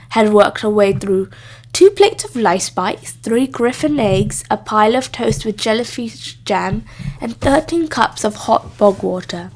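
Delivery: plain reading voice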